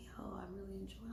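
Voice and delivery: quick hushed mimic of his text; imitating the guy. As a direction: toss off his line in a fast whisper. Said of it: "He's all like".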